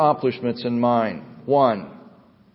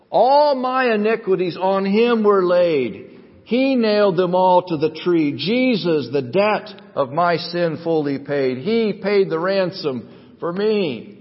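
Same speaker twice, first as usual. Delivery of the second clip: fervent chorus recital, pitched up at first; impassioned hymn recitation; 5 semitones higher, 4 dB louder than usual. Acted. Preacher declaims hymn's chorus about the ransom, impassioned recitation. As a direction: declaim the hymn, stretching the final phrase.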